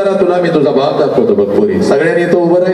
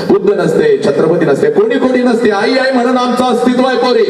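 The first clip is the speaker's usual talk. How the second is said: raised, high-pitched appeal to the crowd; impassioned preaching; 4 semitones higher than usual. Acted. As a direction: preach with rising fervour about mothers. Emotional appeal to students. (उंच आवाजात भावनिक प्रवचन)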